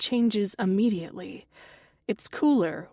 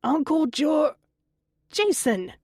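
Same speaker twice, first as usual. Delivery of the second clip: higher, louder cut-off yell; mother cutting off her son's yelling; shouting back; 7 semitones higher, 4 dB louder than usual. Acted. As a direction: snap at him mid-word. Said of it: "Connie shouts back".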